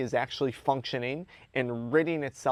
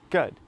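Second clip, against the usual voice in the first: "Good" punched out as a standalone word; emphatic "Good"; 3 semitones higher, 6 dB louder than usual. Acted. To himself facing a long shift, emphatic "Good". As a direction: land hard on "Good", short and decisive. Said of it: "emphasis on the word good".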